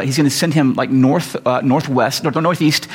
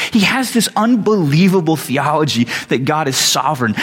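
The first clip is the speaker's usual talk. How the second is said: usual speaking voice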